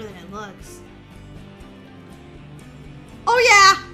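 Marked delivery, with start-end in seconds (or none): 3.3-3.8 loud, high whoop of victory; triumphant cheer. Real, not acted. Player making it over the obstacle, triumphant cheer. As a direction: shout it, thrilled to have made it. Made the jump in game.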